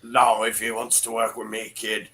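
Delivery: lightly put-on hard-man voice; mock tough talk. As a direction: parrot the friend in a low, self-important voice. Mockingly parrots friend's ultimatum.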